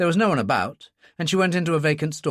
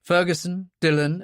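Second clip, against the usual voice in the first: ordinary delivery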